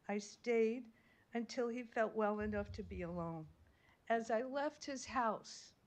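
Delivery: usual speaking voice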